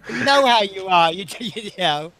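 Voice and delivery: laughing while talking. Real, not acted. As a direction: giggle through the words. Laughing at herself.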